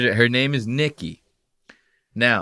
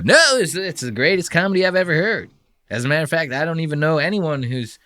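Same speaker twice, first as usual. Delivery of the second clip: high-pitched, over-eager mock praise; gushing fan; 5 semitones higher than usual. Host mocking Mark praising Norton's comedy, gushing. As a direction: gush in a raised, hammed-up voice.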